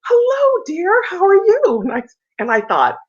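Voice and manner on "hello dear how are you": high, sweet mock greeting of the famous singer; imitating a gracious greeting. Retelling how the diva greeted her in the lobby, high and sweet. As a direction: lift it way up, gushing and gracious. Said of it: "and she said".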